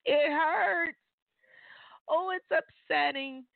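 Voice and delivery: high, hammed-up whimper; mock-cry the complaint; playful whine of hurt. Believer owning that forgiving repeat offenders hurts, mock whining.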